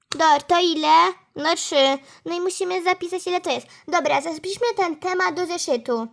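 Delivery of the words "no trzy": usual speaking voice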